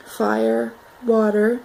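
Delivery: normal talking voice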